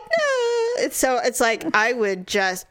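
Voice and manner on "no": very high stubborn no; defiant squeak. Retold or imitated. Girl refusing to change, very high no. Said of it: "I'm like".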